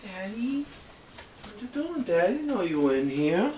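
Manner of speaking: high, sing-song talk to a dog; pet-talk voice. Real, not acted. Owner finds the dog left in the room, high pet-talk voice. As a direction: coo to your dog in a high, soft voice.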